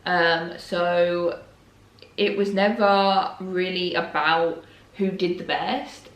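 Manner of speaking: ordinary talk, nothing marked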